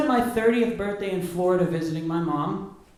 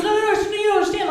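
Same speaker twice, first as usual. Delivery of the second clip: much higher, fast panicked backpedal; flustered mom; 11 semitones higher, 4 dB louder, faster than usual. Retold or imitated. Mom backpedaling to her shocked son, high fast and flustered. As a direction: scramble to take it back.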